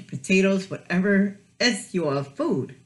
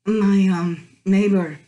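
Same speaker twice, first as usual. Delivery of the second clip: ordinary delivery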